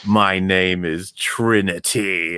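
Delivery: "My name is Trinity" dragged out very slowly; slow, laboured delivery of "My name is Trinity"; mock clunky declaration. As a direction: imitate a ponderous name reveal, stretching every word. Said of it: "When she said".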